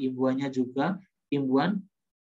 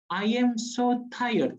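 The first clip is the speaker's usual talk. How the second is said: 1 wrong form said high; 7 semitones higher, much slower than usual. Shown as a demonstration. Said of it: "Jangan bilang".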